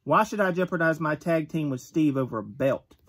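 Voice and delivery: ordinary delivery, nothing marked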